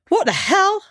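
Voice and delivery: high-pitched startled shout; indignant shout. A narrator's reading. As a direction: cry out in outraged disbelief. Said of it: "shouted Razor".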